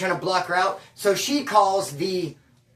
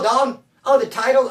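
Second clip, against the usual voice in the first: ordinary talk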